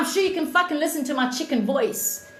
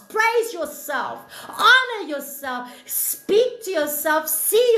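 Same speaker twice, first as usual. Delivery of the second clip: preaching fervently; 5 semitones higher than usual. Real, not acted. Preaching self-worth to viewers.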